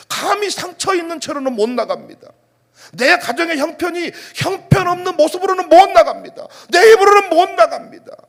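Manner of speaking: loud, high, impassioned sermon delivery; forceful preaching. Real, not acted. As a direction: build to a shouted declaration from the pulpit.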